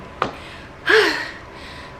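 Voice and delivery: long winded exhale after running; breathless heavy sigh. Real, not acted. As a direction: gasp out a tired breath. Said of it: "Sorry I'm out of breath".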